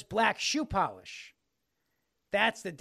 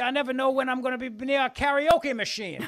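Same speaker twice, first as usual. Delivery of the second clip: louder Southern politician bluster; Ralph Northam impression; 4 semitones higher, 5 dB louder than usual. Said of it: "I don't do many impressions".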